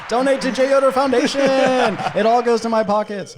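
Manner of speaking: loud, high mock announcement shout; hammed-up shout asking Michael Jordan to donate; joking shout. Real, not acted. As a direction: bellow it like an on-air plea.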